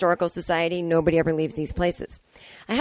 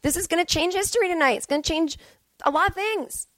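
mock excited; 6 semitones higher than usual. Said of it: "we were like".

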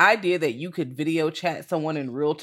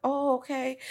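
higher, mildly stunned; taken-aback okay; 8 semitones higher, 3 dB quieter than usual. Retold or imitated. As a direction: act a little caught off guard. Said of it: "I was like".

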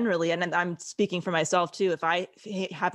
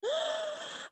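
much softer, high, breathy laugh through tears; soft teary laugh; 8 dB quieter than usual. Real, not acted. Host gathering herself after crying, soft tearful laugh. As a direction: let a small wet laugh slip out. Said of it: "I'm, like, getting emotional".

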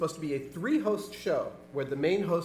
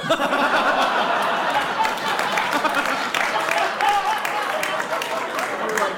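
loud laughter; 10 semitones higher, 7 dB louder than usual. Real, not acted. Big laugh after script quote.

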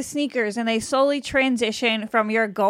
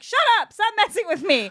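pitched-up mock outrage; playful disbelief; 8 semitones higher than usual. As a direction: tease back in disbelief. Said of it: "I'm like".

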